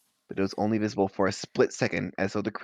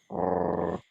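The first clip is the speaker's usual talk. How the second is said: lower, growly dog voice for Lupin; 4 semitones lower, much slower than usual. Acted. Lupin as a dog, a lightly voiced growl. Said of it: "more like a dog voice".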